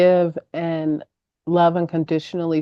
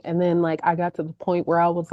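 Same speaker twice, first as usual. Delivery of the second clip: ordinary talk, nothing marked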